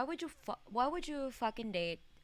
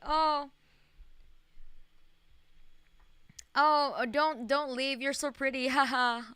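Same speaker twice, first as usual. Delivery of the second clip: higher, louder mock-gushing voice; mock dialogue voice; 5 semitones higher, 6 dB louder than usual. Streamer mocking dialogue option three, higher gushing voice. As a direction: gush like a desperate suitor. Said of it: "the number three screams like".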